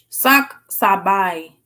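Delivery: slow "Sak Sabaye" with "Sak" stressed; careful repeat. Shown as a demonstration. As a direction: sound out "Sak Sabaye" slowly. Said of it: "what'd you say?".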